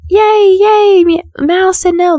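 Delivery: high, loud, hammed-up squeal of joy; mock cheering. Mocking Ayanna celebrating the rejection, in a high loud fake cheer. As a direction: gush like a fake-happy rival. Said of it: "expect Ayanna to be like".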